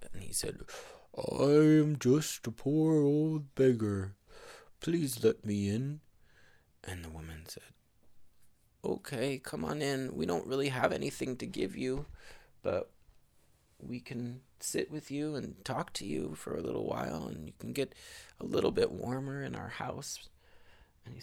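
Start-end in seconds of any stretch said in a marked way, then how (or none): 1.2-6.0 higher, slow pleading beggar voice; poor old beggar. Acted. Disguised Harry at the door, slow beggar plea. 8.8-20.3 put-on higher, welcoming old woman's voice; kind old woman. Old woman welcoming the beggar, kind higher voice.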